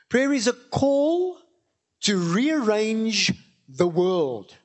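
slow, weighty sermon line with a rise on call; slow preaching. Acted. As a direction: lean into each word like a preacher. Sermon thesis, slow emphatic.

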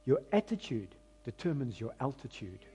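soft emphatic saying. Shown as a demonstration. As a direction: let the saying sink in quietly. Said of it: "I'm gonna say it again".

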